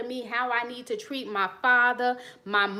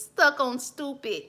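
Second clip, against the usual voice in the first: slow, higher mock taunt; old catchphrase; 4 semitones higher, slower than usual. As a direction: drawl out the old playground taunt. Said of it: "Like they used to say".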